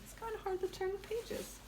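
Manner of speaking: quiet aside. Pretend. Joking aside as a cat.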